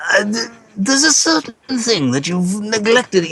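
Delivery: high, slow fake English accent. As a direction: wise old wizard in fake English accent. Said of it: "the fake English accent".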